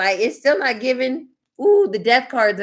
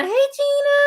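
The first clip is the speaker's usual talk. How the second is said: singsong greeting; 3 dB louder than usual. Real, not acted. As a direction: sing out a high-pitched greeting to a viewer. Singsong greeting to arriving viewer.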